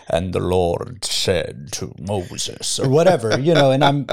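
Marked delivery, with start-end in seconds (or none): 0.1-2.8 slow, rolling Scottish brogue like a preacher from Scotland; a Sean Connery-style Scottish accent. Retold or imitated. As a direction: give it a mock Scottish preacher's brogue, slowly. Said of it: "this Scottish brogue".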